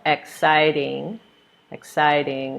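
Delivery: "exciting" slowly, weight on its second syllable; second-syllable stress. Shown as a demonstration. Teacher showing syllable stress in a word, stressed second syllable of "exciting". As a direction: lean on the CIT in "exciting". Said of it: "we're stressing the second syllable".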